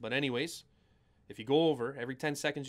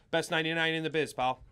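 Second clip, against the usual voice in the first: ordinary delivery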